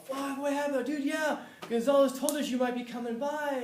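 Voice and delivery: high, loud party-guy greeting; excited buddy. Retold or imitated. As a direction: hype it up like an old pal at a party. Classmates voiced greeting him.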